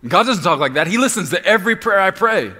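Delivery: loud high indignant protest; objector. Acted. Imagined listener arguing with the preacher, loud indignant. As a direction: insist like a stubborn offended listener.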